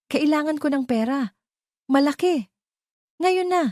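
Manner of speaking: high, frightened pleading voice; panicked aunt demanding money, pitched high; fearful aunt. A narrator's reading. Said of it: "Ang boses ni Tita Lorna ay puno ng takot".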